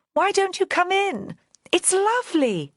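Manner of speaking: a carefree posh woman inviting a friend into the water; playful posh lady calling. Acted. Joanna calling from the tarn.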